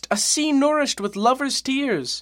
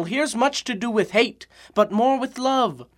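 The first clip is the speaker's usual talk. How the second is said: usual speaking voice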